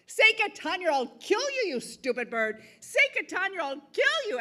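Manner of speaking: high, loud angry threats; irritated threat. Acted. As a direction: yell at the stubborn bird. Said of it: "the man began to get irritated".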